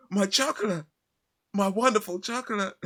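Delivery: very high mock-anguished wail; hammed-up lament over a spoiled treasure; Willy Wonka. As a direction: imitate a distraught chocolatier in a high voice. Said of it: "he's like".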